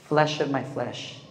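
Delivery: slow, low and soft; hushed, unhurried tenderness; tender declaration. Acted. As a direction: quote it like an intimate vow. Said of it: "We have to talk like that".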